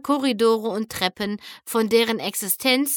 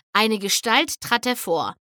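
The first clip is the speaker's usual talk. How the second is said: ordinary delivery, nothing marked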